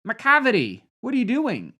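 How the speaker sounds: high, loud mock telling-off; exasperated scolding. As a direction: call out to the culprit in disbelief. Scolds the film character directly.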